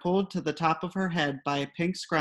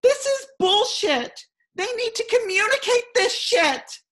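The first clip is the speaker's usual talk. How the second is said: a half-dressed neighbor imitated screaming in outrage; furious mock shriek, very high; 6 dB louder than usual.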